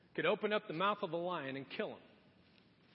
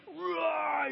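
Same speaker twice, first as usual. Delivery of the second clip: high, acted roar; mock strongman roar; 8 semitones higher, 4 dB louder than usual. Samson breaking free of everything, roaring. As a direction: roar as if tearing loose.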